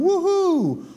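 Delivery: high whooping cheer; mock cheer. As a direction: whoop like a fan at a parade. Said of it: "How did they go from".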